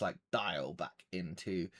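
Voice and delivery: ordinary talk, nothing marked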